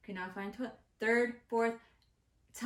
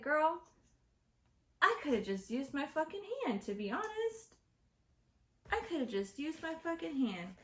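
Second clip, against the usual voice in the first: high-pitched, incredulous comeback; exasperated retort; 6 semitones higher than usual. Retold or imitated. Speaker complaining to friend about thin paper, exasperated. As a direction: protest to a friend in exaggerated disbelief.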